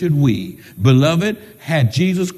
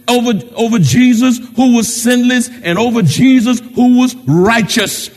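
loud, rhythmic pulpit cadence; raised, measured sermon delivery; forceful preaching; 6 semitones higher, 5 dB louder than usual. Real, not acted. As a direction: hammer each repeated phrase for the congregation. Preaches with building repetition.

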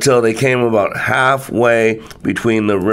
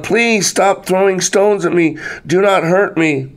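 higher-pitched plea, only mildly raised in volume; calling out loud; 7 semitones higher than usual. A narrator's reading. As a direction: call out a plea in a lifted pitch. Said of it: "said out loud".

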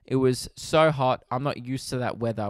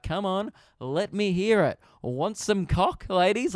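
higher, drawn-out crowd-teasing call; stripper hyping crowd; 6 semitones higher, slower than usual. Retold or imitated. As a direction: work the audience like a cheesy stripper.

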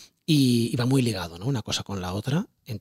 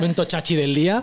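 laughing; 6 semitones higher, 4 dB louder than usual. Real, not acted. Jokey Instagram moment.

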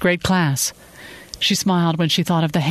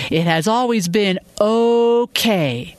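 slow, higher-pitched, bitterly drawn-out okay; spitting out okay; 6 semitones higher, slower than usual. A narrator's reading. Woman throwing his okay back at him, bitter and spat out. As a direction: spit out the okay as if in another voice. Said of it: "She spit out the okay with a voice".

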